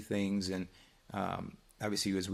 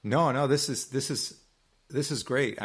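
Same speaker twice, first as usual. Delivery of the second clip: higher and louder, eager to jump in; excited agreement; 6 semitones higher, 5 dB louder than usual. Real, not acted. Jumping in to tell the co-host this is great, excited. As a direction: cut in with real enthusiasm.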